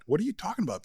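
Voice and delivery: mock bewildered friend, voice jumping high; confused friend. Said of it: "her friend's like".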